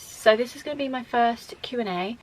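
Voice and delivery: ordinary delivery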